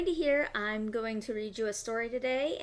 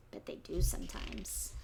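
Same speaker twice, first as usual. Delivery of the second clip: soft lower whisper; 3 semitones lower, 5 dB quieter than usual. Real, not acted. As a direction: confide it in a whisper. Aside to the children.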